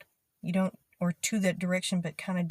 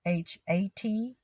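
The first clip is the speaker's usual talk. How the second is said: usual speaking voice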